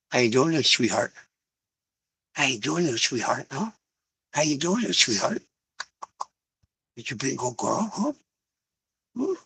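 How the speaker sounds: cooing to dog. Real, not acted.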